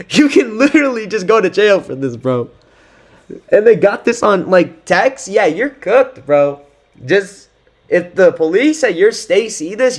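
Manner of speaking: can't believe he texted a threat; excited disbelief. Real, not acted.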